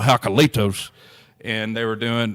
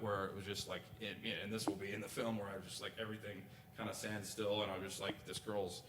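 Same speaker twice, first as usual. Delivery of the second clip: hushed awe; 23 dB quieter than usual. Real, not acted. Moment where everything stands still.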